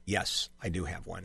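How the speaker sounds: host showing how he should have answered the caller; calm. Shown as a demonstration. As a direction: say it calmly and softly, no rush. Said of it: "I should have just calmly said".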